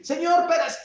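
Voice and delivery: high, loud complaining excuse; whining excuse. Pretend. Peter excusing himself to the Lord: high whining shout. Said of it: "Tú puedes decir".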